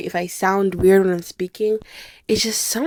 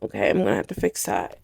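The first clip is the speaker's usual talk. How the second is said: much lower, muttering to herself; low mumbled quote; 12 semitones lower, 5 dB quieter than usual. Retold or imitated. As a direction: drop way down low and grumble. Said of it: "and I was like".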